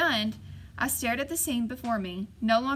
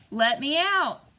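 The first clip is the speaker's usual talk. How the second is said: louder, higher shout with an odd, strange timbre; harsh cry; 4 semitones higher, 7 dB louder, slower than usual. A narrator's reading. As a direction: scream to be let out. Sack voice cries out again.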